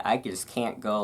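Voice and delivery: normal talking voice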